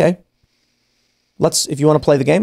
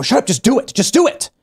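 urgent hiss; 9 semitones higher than usual. Retold or imitated. Friends urging him to comply.

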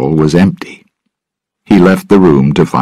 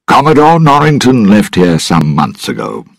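higher-pitched, hurried reply; quick answer; 7 semitones higher, 3 dB louder than usual. A narrator's reading. Governor answers the lord about the commodore, quickly. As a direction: answer promptly, a little hastily. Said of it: "the governor answered quickly".

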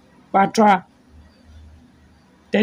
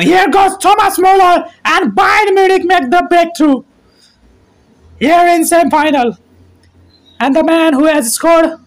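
shouted, much higher goal call, easing off after; 5 semitones higher than usual. Real, not acted. Commentator calling Muller's goal for viewers, excited shouting. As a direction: roar the goal, then settle down.

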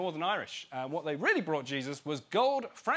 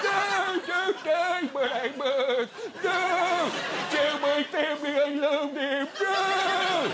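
high, loud hammed-up war-film death scene; dying soldier; 9 semitones higher, 4 dB louder, much slower than usual. Sheep soldier begging a dying comrade on the battlefield, hammed-up anguish. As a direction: ham up a fallen soldier's last words.

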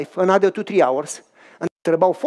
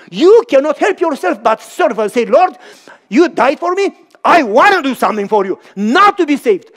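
higher, louder, fervent preaching; 7 semitones higher, 7 dB louder than usual. Real, not acted. Preacher voicing the believer to the Lord, loud fervent preaching. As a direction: preach it to the rafters.

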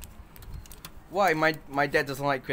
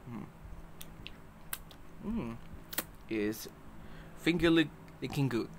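chewing while talking; 8 dB quieter than usual. Real, not acted. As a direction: mouth partly full. Seen 3: Closed working mouth while talking, then fingers licked at 3.6–4.3.

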